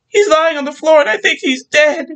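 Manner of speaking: high, loud tearful cry; distraught. Acted. Wife reporting husband's body, high distraught cry. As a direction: plead through panic.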